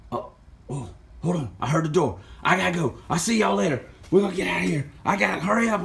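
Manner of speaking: lightly voiced, hurried high figure; hurried character. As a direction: rush off when the door sounds. Character hears the door, hurries.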